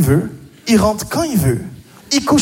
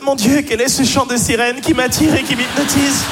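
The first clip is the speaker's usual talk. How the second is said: high, hammed-up swooning voice; mock-enchanted woman; 5 semitones higher than usual. Woman answering the catcaller, mock enchantment. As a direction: pretend to be utterly spellbound. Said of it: "Comme si les femmes allaient faire".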